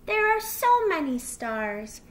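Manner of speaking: lightly acted childlike amazement; sighing wonder. A narrator's reading. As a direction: marvel like a small child. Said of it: "he sighed".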